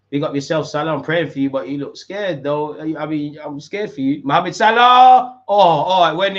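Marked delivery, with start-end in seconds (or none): 4.2-6.4 slow, loud, high drawn-out name; tense drawn-out call. Real, not acted. Fan willing Salah to score the penalty, tense drawn-out call. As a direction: stretch the name as the kick is taken.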